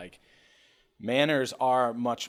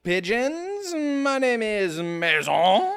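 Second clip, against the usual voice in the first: high, slow, hammed-up French host voice; mock French accent; 6 semitones higher, 7 dB louder, much slower than usual. Greeting viewers in a hammed-up French accent. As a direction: roll it out like a fake French gentleman. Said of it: "Why are we French?".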